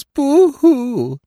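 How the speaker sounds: high, hammed-up fake sob; a badly faked wail of grief; mock boo-hoo. Actress teasing the stranger with a mock boo-hoo sob. Mock crying in a theatrical sulk.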